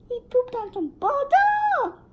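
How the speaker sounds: high loud amazed yell; high loud delighted yell; excited shout. Real, not acted. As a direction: shout it out in disbelief. Laughing shock at game.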